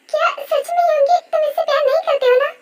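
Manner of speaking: high-pitched, urgent plea for confirmation; eager, emotional questioning. Acted. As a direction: seize on it, voice lifted high. Rose seizes on the confession.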